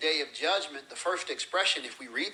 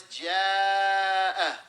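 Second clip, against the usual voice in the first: "جَاءَ" with a very long, loud held aa; slow, stretched-out recitation of "جَاءَ"; elongation; 3 semitones higher, 5 dB louder, slower than usual. Shown as a demonstration. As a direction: sustain the vowel of "جَاءَ" before the final stop. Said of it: "it's a long".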